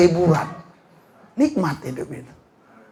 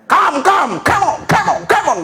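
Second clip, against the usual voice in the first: calling out; 9 semitones higher than usual. Acted. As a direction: call them to come running. Jesus standing, calling you.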